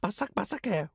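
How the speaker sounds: high, surprised 바삭바삭해 copying the learner; mock wow at crispy chicken; imitating surprise. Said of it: "You sounded very surprised".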